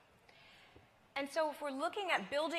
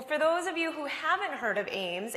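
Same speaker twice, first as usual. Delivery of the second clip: usual speaking voice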